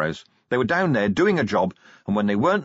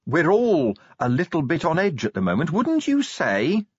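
menacing; 8 semitones higher than usual. A narrator's reading. Angua menacing the watchman.

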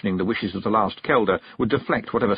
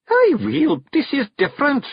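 much higher, louder uneasy Scottish start; husband caught out, squeaky; flustered Rob; 4 dB louder than usual. Acted. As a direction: bluster and falter.

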